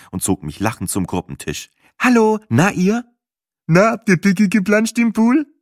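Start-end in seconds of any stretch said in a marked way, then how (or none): 2.0-5.5 much higher, louder chirpy greeting; cheery greeting voice. Acted. Tablemate hails the couple, chirpy high greeting. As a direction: greet them brightly.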